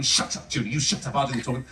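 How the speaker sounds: low, unvoiced hushed dad telling mum off; dad snapping. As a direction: hiss it low like a fed-up husband.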